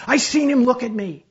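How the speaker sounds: high, loud insistent shout; yelled back. A narrator's reading. Swimmer insists the drowning man lives, desperate yelling. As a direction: yell it desperately from the water. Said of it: "Wally yelled back, gulping water".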